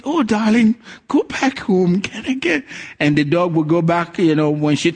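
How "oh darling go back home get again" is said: higher, coaxing voice of the lady to her dog; sweet-talking lady. Retold or imitated. The lady sweetly coaxing her dog back home. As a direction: gently shoo the little dog home. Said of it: "And she said".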